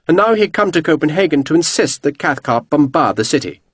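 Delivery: usual speaking voice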